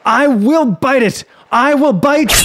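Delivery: high, loud furious shout; angry cry. Acted. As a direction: scream it in rage. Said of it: "cried the man in great anger".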